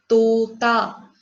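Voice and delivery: तोता with the ओ stretched long and loud; Hindi: तोता में ओ को लंबा खींचकर; long o sound. Shown as a demonstration. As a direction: draw out the ओ in तोता slowly. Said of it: "इस पर हमें जोर डालना है".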